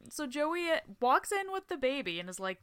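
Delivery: ordinary talk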